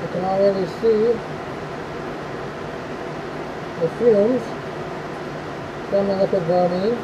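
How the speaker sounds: muffled, speaking through a respirator mask. Real, not acted. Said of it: "I'm all geared up in the respirator".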